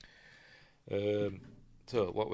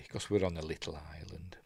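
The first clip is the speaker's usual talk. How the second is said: whispering; 5 semitones lower, 8 dB quieter, faster than usual. Real, not acted. Aside about Britain being small.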